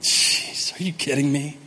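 hushed, half-whispered disbelief; stunned aside. Retold or imitated. Peter muttering to himself in the boat, hushed disbelief. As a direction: breathe it out in disbelief. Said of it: "he went".

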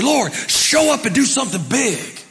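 loud, high demanding prayer; mock big prayer. Pastor imitating the church's prayer, loud mock demand. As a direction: shout it like a crowd begging for fireworks. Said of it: "We say".

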